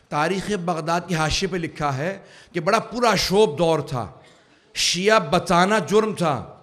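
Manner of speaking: impassioned preaching. Real, not acted. Preacher recalls Baghdad's dangerous days for Shias, impassioned. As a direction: drive each phrase home to the crowd.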